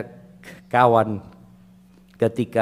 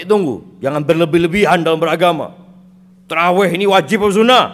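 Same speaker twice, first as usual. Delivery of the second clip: higher, louder mock of a fussy questioner; mocking quote; 4 semitones higher, 6 dB louder, faster than usual. Preacher mocks worshippers who ask if tarawih is required, mocking voice. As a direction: ham up the nitpicker's objection.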